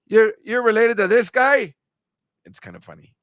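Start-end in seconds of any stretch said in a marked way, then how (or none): 0.0-1.7 louder, higher teasing imitation of Tommy's accent; imitating Tommy's accent. Host mimics Tommy teasing his sister, loud accented imitation. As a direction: play the bartender ribbing the sister in his accent. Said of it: "There's nothing better than that accent".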